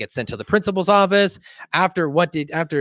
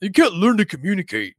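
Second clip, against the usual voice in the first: mildly mock-tearful complaint; a touch of hammed-up heartbreak; breaking down; 5 semitones higher than usual. Satan complaining about Saddam, mock breakdown. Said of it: "And he s-, breaks down".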